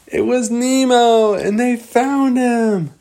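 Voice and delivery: high, slow, drawn-out excited narration; excited reveal. A narrator's reading. Storyteller revealing Nemo is found, excited and high. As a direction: stretch out the big reveal high.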